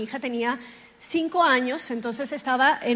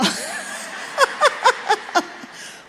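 high, breathy burst of real laughter; 11 semitones higher than usual. Real, not acted. Laughs at her own comparison. (carcajada aguda y soplada)

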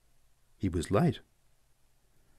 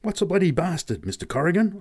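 higher breathless question; gasped question; 9 semitones higher than usual. Acted. Boy asking Pat on the jolting cart, higher gasping question. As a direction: ask it short of breath, bounced about. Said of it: "I gasped at last".

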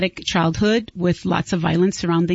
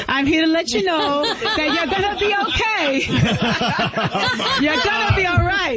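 laughing through tears; 10 semitones higher than usual. Real, not acted. Emotional reassurance after painful story.

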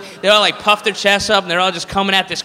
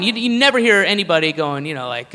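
normal talking voice

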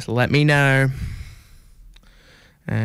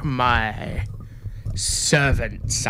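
vampire voice; much slower than usual. Pretend. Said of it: "This is supposed to be a vampire voice".

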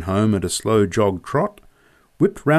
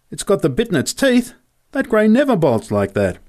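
alarmed answer; 10 semitones higher than usual. A narrator's reading.

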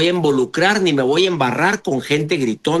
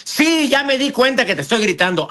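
higher-pitched defiant comeback; still shouting; 7 semitones higher than usual. Acted. Yeller answering back when told, still shouting. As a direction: play the yeller who will not back down. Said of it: "si te siguen gritando".

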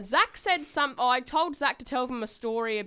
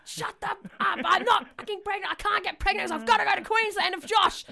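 high-pitched angry snapping back, imitating herself; pitched-up mock rage at the family; furious retort; 9 semitones higher than usual. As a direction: fire it back at grandma, outraged and shrill-high. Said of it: "I turn around, I'm like".

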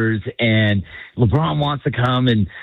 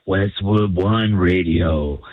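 low, slow station-ID voice; radio announcer; 5 semitones lower, much slower than usual. Acted. Caller imitating the network's station ID, radio announcer voice. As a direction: drop low and draw out the station name. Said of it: "I hear".

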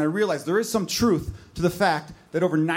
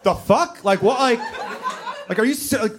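laughing disbelief; 4 semitones higher than usual. Real, not acted. Reacting to shocking remark.

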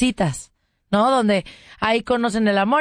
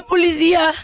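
mock panicked repeat; 6 semitones higher, 4 dB louder than usual. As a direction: stretch "policía" into a pleading high wail. Fake panicked call to police.

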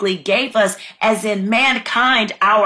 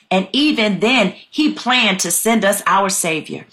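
usual speaking voice

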